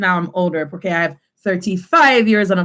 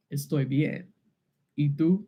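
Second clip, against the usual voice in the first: low, very soft mock-male reply; subdued, deep-pitched role-play voice; masculine voice; 7 semitones lower, 9 dB quieter than usual. Shown as a demonstration. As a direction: answer quietly down in the man's register. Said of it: "a masculine sounding voice".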